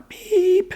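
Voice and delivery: very high held voicemail beep; badly faked answering-machine beep; imitated beep. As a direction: squeak out a machine tone by mouth. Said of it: "I still can't do beep noises".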